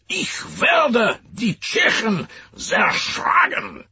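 slow, high German ranting shout; Hitler tirade. Retold or imitated. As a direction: rant like a furious dictator. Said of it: "one of Herr Hitler's tirades".

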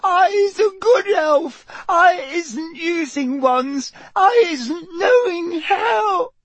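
wailing, very high terrified elf; slow, stretched squeals building to a cry; Winky. A narrator's reading. The elf pleads innocence to Mister Diggory, terrified wailing. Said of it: "her eyes rolling in terror".

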